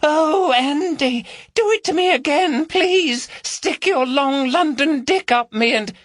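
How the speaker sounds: high falsetto mimicry of a woman; mocking female voice. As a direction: camp it up as a moaning woman to tease. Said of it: "mimicked a female voice".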